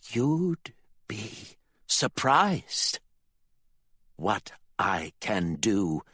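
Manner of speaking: slow sinister taunt; menacing father. Acted. The father taunts Candy, slow and menacing. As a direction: savour the threat.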